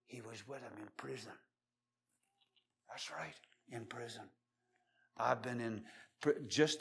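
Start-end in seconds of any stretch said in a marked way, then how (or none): 0.1-4.2 soft whisper; soft, intimate whisper; hushed sermon aside. Acted. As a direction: drop to a whisper for effect.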